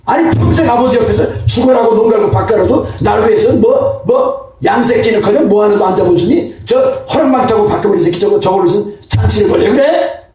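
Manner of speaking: 억울해서 언성을 높이는 큰형; 동생 잔치에 분통 터뜨리듯 크게. Retold or imitated. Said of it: "큰형이 뭐 성질이 막". (동생 잔치에 화가 난 큰형이 아버지에게 따지듯 외치는 목소리)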